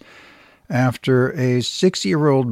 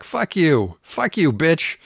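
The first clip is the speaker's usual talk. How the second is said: mock repeated curse; 5 semitones higher, slower than usual. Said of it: "the kid shoots back".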